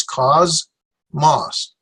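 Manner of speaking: "cosmos" split slowly as KOZ, pause, MOS; drawn-out "cosmos" in two separate syllables. Shown as a demonstration. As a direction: break "cosmos" between s and m. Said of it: "won't go together well".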